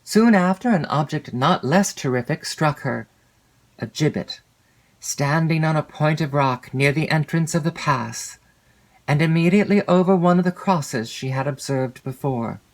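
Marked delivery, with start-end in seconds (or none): none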